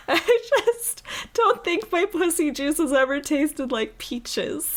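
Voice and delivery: high-pitched, talking through laughter; cracking up, barely getting words out; real helpless laughter. Real, not acted.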